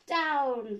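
loud falling command; witch's cry. A narrator's reading. Witch ordering the broom down, loud cry. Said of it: "cried the witch".

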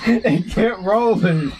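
stifled giggles. Real, not acted. Laughing at a fail video.

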